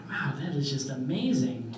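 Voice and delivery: mock-awed, laughing through it; sarcastic. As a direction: gush with fake admiration. Sarcastic praise of father.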